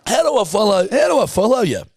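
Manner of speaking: mock fan. Mock awed fan question.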